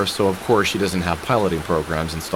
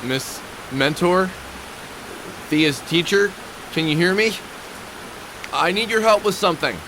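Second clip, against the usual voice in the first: calling up high to someone far off; raised high-pitched calls across a gap; 8 semitones higher than usual. Acted. Calling to android across building.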